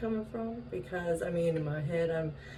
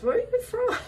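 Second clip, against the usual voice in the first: very high, loud retold question; amused mimicking; 5 dB louder than usual. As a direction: squeal the question, close to laughing. Cracking up retelling his question.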